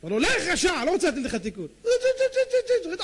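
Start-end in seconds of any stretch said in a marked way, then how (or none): 0.2-1.7 high, loud rebuke; acted angry dismissal; stern banishment. Rabbi dismissing the wicked mouse. 1.8-3.0 very high, repeated squeaks; piercing little rodent shrieks; mouse squeal imitation. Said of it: "צועק לו".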